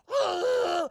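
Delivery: loud high Wookiee roar; Chewbacca scream. Acted. Chewbacca screaming as Han starts shooting, loud roar. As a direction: let out a big mock Wookiee yell. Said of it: "just starts screaming".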